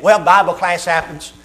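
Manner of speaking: ordinary talk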